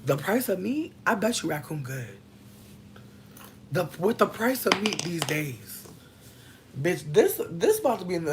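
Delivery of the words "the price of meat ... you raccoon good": normal talking voice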